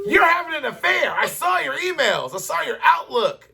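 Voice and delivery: high, fast hammed-up accusing girlfriend voice; mock jealous girlfriend. Mocking a jealous girlfriend who found his emails, high and shrill-free squeaky.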